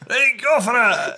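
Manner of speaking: high, loud, drawn-out order; mock raid command. Raid leader as men jump from van, mock shout. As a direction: send the squad in with a mock battle cry.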